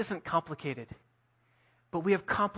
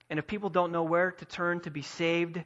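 ordinary talk, nothing marked

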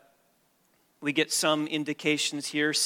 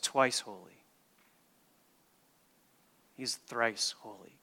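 hushed reverence; 2 semitones lower, 7 dB quieter than usual. Real, not acted. Preacher telling congregation God is thrice holy, hushed reverence. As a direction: drop almost to a whisper in awe. Hushed awe at God's holiness.

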